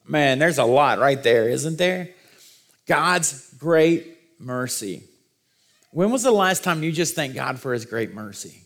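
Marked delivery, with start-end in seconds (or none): 2.9-5.0 very slow, word by word; slow emphasis. Shown as a demonstration. Preacher lingering on the verse's key phrase, slowly emphatic. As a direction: dwell on "God's great mercy", pausing between the words.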